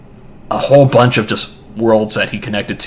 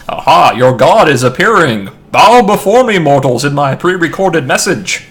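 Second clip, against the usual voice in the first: booming, much higher god voice, louder; dark lord; 6 semitones higher, 8 dB louder than usual. Pretend. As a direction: bellow like a fake deity to mortals. Faking a god's recorded message.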